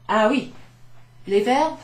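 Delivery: loud, bright-eyed fake delight; mock enthusiasm. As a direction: oversell the joy of verbs. Ironische Begeisterung für Grammatik.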